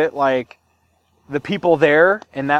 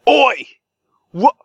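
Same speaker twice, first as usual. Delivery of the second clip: imitating a British dock greeting, higher and slow; hammed-up British Oi mate; British accent; 7 semitones higher, 4 dB louder, much slower than usual. Mimicking Victoria locals greeting him off the boat, British accent. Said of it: "they're like".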